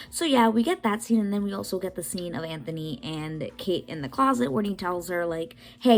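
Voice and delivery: upbeat voiceover catching viewers up; voiceover recap. Real, not acted.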